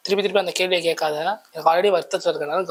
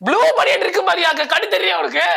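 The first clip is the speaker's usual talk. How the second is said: high, loud scolding yell; exasperated shout; 9 semitones higher, 3 dB louder than usual. Acted. Scolding the interviewer over the blue vest, loud high yell. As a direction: bark at him like he's blind.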